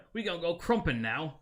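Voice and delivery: high, loud cockney orc brag. Retold or imitated. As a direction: belt it out like a rowdy Warhammer orc. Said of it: "that's how they talk to each other".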